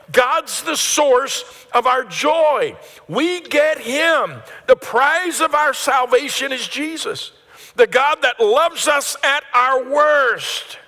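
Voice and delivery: high, loud, slow proclamation; full-force preaching. Acted. Pastor proclaiming God as the prize to the church, loud and high. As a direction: lift the roof with it, drawn out.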